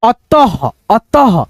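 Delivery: अतः with a short echoed h after the त; careful teaching visarga in अतः; half-H ending. Shown as a demonstration. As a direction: finish अतः on a puff of ह. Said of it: "ह मिला देंगे त में".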